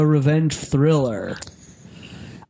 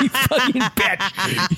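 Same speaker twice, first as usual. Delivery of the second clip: higher, drawn-out mock-angry curse; Chucky; 5 semitones higher, much slower than usual. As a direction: imitate an angry doll, laughing it off. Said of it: "He's so angry".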